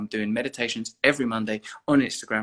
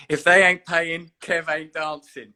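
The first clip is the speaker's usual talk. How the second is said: Kevy Clifton formula; 4 semitones higher, 8 dB louder, slower than usual. Retold or imitated. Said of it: "that's the Kevy Clifton formula".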